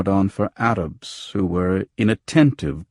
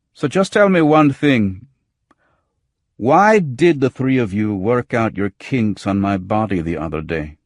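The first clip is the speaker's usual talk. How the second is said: higher, louder challenge that drops back at the end; angry demand; 3 semitones higher, 6 dB louder than usual. Acted. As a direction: confront her with the grudge. Narrator confronts Salima about the beating.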